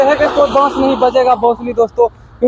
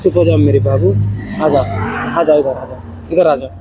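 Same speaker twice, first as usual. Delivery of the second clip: tender coaxing; 7 semitones lower than usual. Real, not acted. Coaxing wife over.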